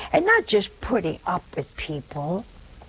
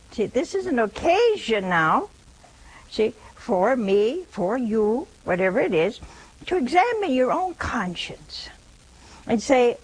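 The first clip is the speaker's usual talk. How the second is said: ordinary delivery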